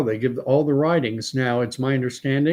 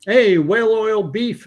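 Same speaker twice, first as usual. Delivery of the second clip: excited greeting; 9 semitones higher, 5 dB louder than usual. Real, not acted. Greeting a chat viewer.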